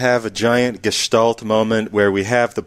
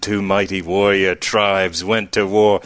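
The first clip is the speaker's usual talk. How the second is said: movie trailer narrator; 3 semitones lower than usual. Pretend. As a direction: intone it like a film prologue. Reciting Mad Max narration.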